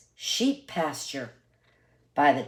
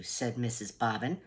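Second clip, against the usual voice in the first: usual speaking voice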